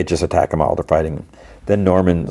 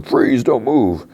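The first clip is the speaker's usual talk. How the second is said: high, drawn-out mock police order; police command; 6 semitones higher, much slower than usual. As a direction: call it out slowly like an officer halting someone. Police order a suspect to stop.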